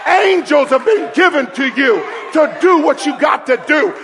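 loud, high shouted preaching; preaching at full force. Acted. Preacher shouting to the church, full force. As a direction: proclaim it to the congregation.

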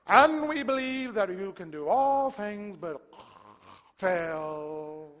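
slow, drawn-out pious prayer; mock a long-winded formal prayer; droning church prayer. Preacher mocking those long prayers, droning. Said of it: "And then there's those prayers".

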